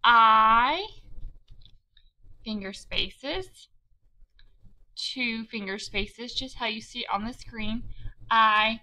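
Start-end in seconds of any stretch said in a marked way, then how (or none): 0.0-0.9 I said firmly, louder; loud, deliberate dictation; dictating each word. Shown as a demonstration. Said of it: "I'm going to say". 2.5-6.3 finger spaces stretched slowly and softly; soft, slow classroom cue; slow reminder. Shown as a demonstration. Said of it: "teacher demonstrates spacing". 8.3-8.8 I said firmly, louder; loud, deliberate dictation; dictating each word. Shown as a demonstration. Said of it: "I'm going to say".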